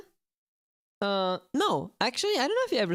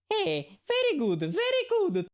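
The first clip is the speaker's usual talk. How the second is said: Mario impression; 13 semitones higher than usual. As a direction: coax Toad like a cheery plumber. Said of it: "Can you do Toad's voice?".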